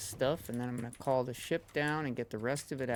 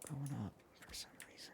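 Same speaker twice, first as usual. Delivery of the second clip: whisper; 12 dB quieter, faster than usual. Real, not acted. Muttering aside while playing.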